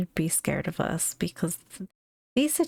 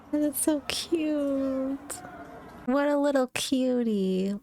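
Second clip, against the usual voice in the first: high, drawn-out adoring coo; 7 semitones higher, slower than usual. Real, not acted. Host reacts to something cute, cooing. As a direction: melt over it, pitched up and slow.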